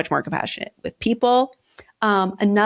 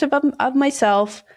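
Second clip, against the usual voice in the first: usual speaking voice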